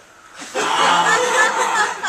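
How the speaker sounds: loud high unvoiced burst of laughter; big real laugh. Real, not acted. Lecturer laughing at his son no longer trusting him, loud real laugh. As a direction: laugh out loud at your own joke. Joke about teenage son.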